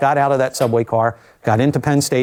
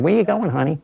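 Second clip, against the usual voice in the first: higher-pitched, lightly put-on New York accent; 5 semitones higher than usual. Amtrak woman waving her finger at a traveller, New York accent. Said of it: "in her finest New York accent".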